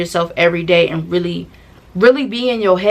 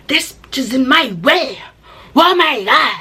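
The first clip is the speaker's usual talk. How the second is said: higher, slower exaggerated complaint; how I heard it; 6 semitones higher, slower than usual. How the narrator heard the friend, higher exaggerated. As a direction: ham it up as an aggressive line. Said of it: "this is how I seen it".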